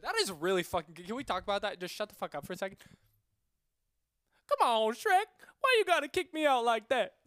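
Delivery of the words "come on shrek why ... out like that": much higher, loud cartoon sidekick; Donkey. Repeating his Donkey for friends, high loud pleading. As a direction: protest at full volume in a high comic voice. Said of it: "I can do a pretty good Donkey".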